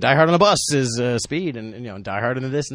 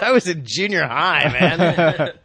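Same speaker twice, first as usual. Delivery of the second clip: imitated retort; 6 semitones higher, 5 dB louder, slower than usual. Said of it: "The guy goes".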